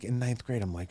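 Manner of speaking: ordinary delivery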